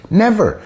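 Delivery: one-word rebuttal, hit hard; emphatic never. Real, not acted. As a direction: slam down the single word Never. Rebutting the unwelcoming charge.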